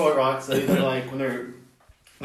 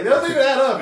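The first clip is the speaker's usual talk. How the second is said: mock outraged fan; 11 semitones higher, 6 dB louder, faster than usual. Fan objecting.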